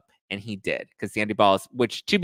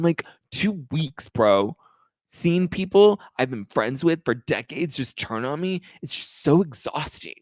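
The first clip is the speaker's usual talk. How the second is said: higher, whining self-pity parody; mock whiny Sandoval; 5 semitones higher than usual. Host imitating Sandoval complaining to boot camp dudes, higher whine. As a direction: whine it like a wounded celebrity, higher.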